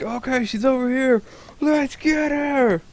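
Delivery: high, drawn-out mock shout; acted rallying call. Game master voicing the fooled party answering, acted rallying call. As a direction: call the gang to charge. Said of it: "They're like".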